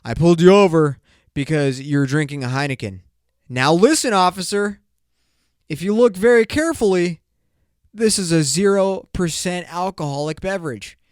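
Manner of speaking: pleading with the officer, getting worked up; mock heated argument. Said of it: "He's like".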